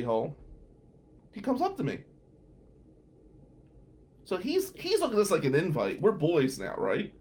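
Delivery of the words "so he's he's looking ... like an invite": usual speaking voice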